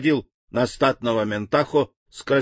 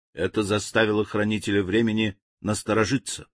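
usual speaking voice

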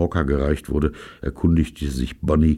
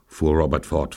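ordinary delivery, nothing marked

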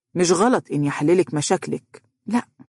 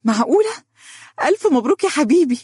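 high, happy exclamation; overjoyed; 11 semitones higher, 3 dB louder, faster than usual. A narrator's reading. Donia congratulating Faris on his promotion, overjoyed. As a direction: squeal congratulations with delighted disbelief, pitch way up. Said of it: "شهقت دنيا فرحا ... وهتفت بسعادة".

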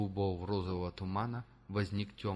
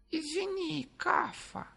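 very high, odd-timbred creature voice; embarrassed apology; slower than usual. A narrator's reading. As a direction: apologize sheepishly in a squeaky voice. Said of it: "смущённо развёл руками Гефар".

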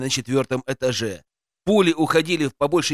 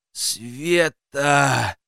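groaning. A narrator's reading. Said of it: "простонал я".

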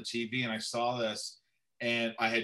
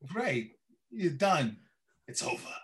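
halting villain voice, long gaps between phrases; Ghoul, Walken-style; 4 semitones higher than usual. Acted. Villain addressing Batman, creepy Walken impression. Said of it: "I did this whole walking".

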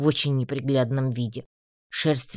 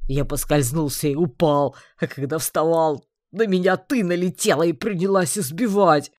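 louder, rising protest; aggrieved shouting; 3 semitones higher, 6 dB louder than usual. A narrator's reading. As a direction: complain loudly, building. Orc complains of being beaten.

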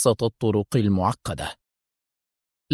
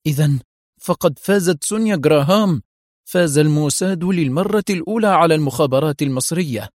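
high, seething through gritted teeth; angry; 5 semitones higher than usual. A narrator's reading. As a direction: spit out the defeat in bitter anger. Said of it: "وقالت وهي تضغط أسنانها في غضب". (بغضب وغيظ عارم)

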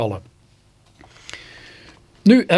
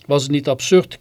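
ordinary delivery

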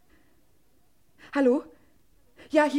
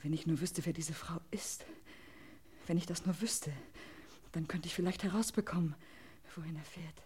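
soft, lower, almost whispered; to herself; 6 semitones lower, 16 dB quieter than usual. Acted. Wife alone after the call, worrying under her breath. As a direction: murmur it to yourself in worry.

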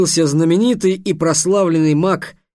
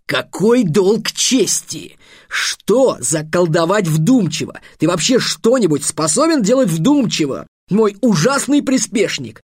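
exasperated, emphatic telling-off with stretched key words; high, indignant outburst; irate wizard master, scolding; 4 semitones higher, 4 dB louder than usual. Acted. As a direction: berate a hopeless apprentice.

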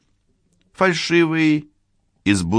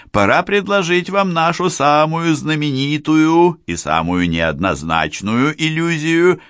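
booming circus ringmaster; 5 semitones higher than usual. Acted. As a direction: hold the crowd like a ringmaster. Ringmaster's speech to audience.

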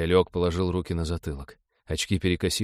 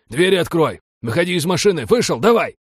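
loud, high, urgent barked orders; hoarse yelled commands; hoarse commanding shouts; 10 semitones higher, 7 dB louder than usual. A narrator's reading. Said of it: "заорали наперебой глухими голосами с командной хрипотцой".

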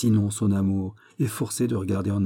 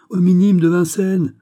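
much louder and higher, urgent; urgent exclamation; 9 semitones higher, 9 dB louder than usual. Acted. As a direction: blurt the place out in alarm. Urgent hurried dialogue. (crié, pressant et bien plus aigu)

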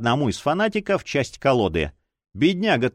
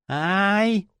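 sharp pain yelp; 7 semitones higher than usual. A narrator's reading. Fanatic cries in pain.

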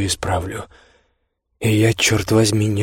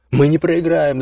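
yelling; 7 semitones higher, 3 dB louder than usual. Acted. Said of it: "заорал я".

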